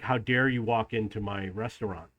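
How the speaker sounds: mock offended glare in the voice; stern owner. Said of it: "giving me this stern".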